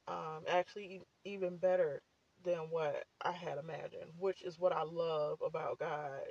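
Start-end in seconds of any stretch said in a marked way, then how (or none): none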